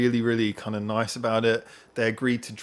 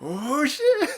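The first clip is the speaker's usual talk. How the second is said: catchphrase imitation; 13 semitones higher, 5 dB louder than usual.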